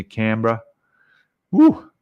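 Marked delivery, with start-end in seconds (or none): 1.5-1.9 high, loud whoop of delight; sudden delighted woo; excited whoop. Real, not acted. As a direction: let out a big happy whoop. Reacting to a chat message.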